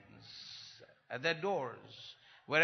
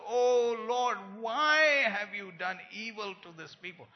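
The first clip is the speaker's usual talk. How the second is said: whining Moses; 6 semitones higher, 9 dB louder than usual. Acted.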